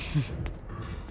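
short breathy knowing laugh; hushed mischievous laugh; soft sly giggle. Acted. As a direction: giggle quietly, keeping a secret. Hinting at naughty plans.

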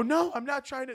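high, soft scared voice; meek denial. Retold or imitated. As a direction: plead weakly. Scared reply to guard.

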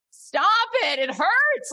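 high, whimpering plea to stop; whiny body. Retold or imitated. Body begging hiker to quit, high whimper. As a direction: beg like sore muscles. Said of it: "my body goes".

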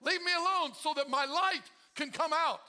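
loud, high shout at the darkness; commanding shout. Retold or imitated. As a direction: order the darkness away with force. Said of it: "he didn't say".

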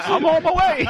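high-pitched laughing reply. Retold or imitated. Laughing as he dodges the girl's question. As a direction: laugh the words out through giggles. Laughing while answering a girl.

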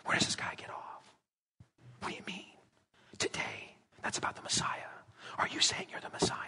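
hushed scandalized whispers; shocked murmurs behind his back; whispering. Retold or imitated. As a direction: whisper it like gossip in the pews. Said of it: "the people that are whispering".

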